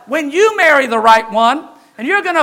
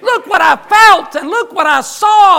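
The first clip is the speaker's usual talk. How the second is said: rising joy; 6 semitones higher, 7 dB louder than usual. Real, not acted. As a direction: proclaim it with mounting joy. Preacher rejoicing after church.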